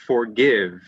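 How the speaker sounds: "forgive" ending on a clear, buzzed v; emphasised final V. Shown as a demonstration. Teacher giving an ending V example, clear final v. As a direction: finish "forgive" fully on the v. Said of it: "using it at the end of a word".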